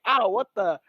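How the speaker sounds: hammed-up cartoon cry of pain; bad Joey Wheeler. Dropped Pikachu yelps, in a bad Joey Wheeler voice. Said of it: "kinda like a bad Joey Wheeler impression".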